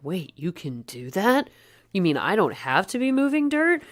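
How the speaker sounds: incredulous questions climbing high; imitating amazed realization, voice rising; mock astonishment. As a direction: act out a worker's dawning disbelief. Retold workers' astonished realization.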